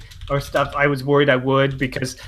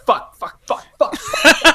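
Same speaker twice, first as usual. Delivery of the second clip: laughing, rapid-fire mock cursing; inner cursing; 11 semitones higher, 4 dB louder, slower than usual. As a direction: repeat it like a frantic thought, breaking up. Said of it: "in my head, I'm just like".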